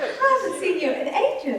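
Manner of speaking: high-pitched hammed-up British small talk; mock British accent. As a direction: put on an exaggerated British lilt. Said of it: "They all slipped into the British accent".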